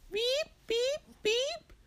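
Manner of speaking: very high imitated electronic beeping; oven timer beeps. Storyteller voicing the oven beeping, high timer sound. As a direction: mimic a timer going off. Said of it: "It was the oven beeping".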